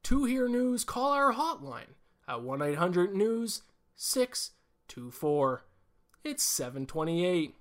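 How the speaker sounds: high, slow, over-announced radio promo voice; radio guy impression. Imitating the bus radio host reading the hotline, slow high promo voice. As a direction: mimic the morning DJ's canned hotline plug. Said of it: "He'd go".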